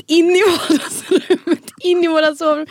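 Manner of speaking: laughing disbelief. Real, not acted. Describing the bathroom smell to her friend, laughing as she goes. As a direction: giggle through it, stretching the words.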